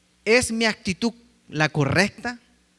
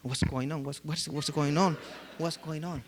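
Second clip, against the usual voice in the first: mock quick American greeting; 2 semitones lower, 7 dB quieter, slower than usual. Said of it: "Y Vía me decía".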